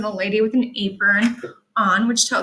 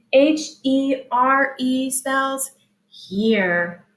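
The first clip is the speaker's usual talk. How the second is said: letters "H E R E" slow; slow letter spelling; spelling out slowly; 2 semitones higher, much slower than usual. Shown as a demonstration. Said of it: "spelling letters".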